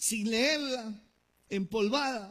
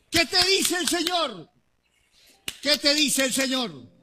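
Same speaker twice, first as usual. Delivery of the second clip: loud, driving preacher's call, louder; rousing preaching; 6 dB louder than usual. Real, not acted. Preacher rousing his congregation to answer, loud and driving. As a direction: push each question at the crowd, preaching at full force.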